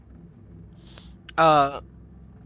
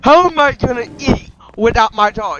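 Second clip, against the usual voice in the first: loud, raised-pitch lament; hammed-up upset protest; distressed complaint; 6 semitones higher, 8 dB louder than usual. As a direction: wail the question in play dismay. Injured character complains.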